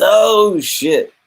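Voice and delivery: high, loud mock cry of shock; shocked outcry. Comic villain struck through the gut, hammed-up shock. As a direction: blurt it out, high and stretched. Villain hit by an iron bar.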